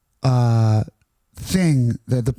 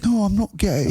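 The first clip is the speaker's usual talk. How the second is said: high-pitched protesting English lad; English-accented denial, pitched up; 7 semitones higher than usual. Acted. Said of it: "Oh, it's English, I guess".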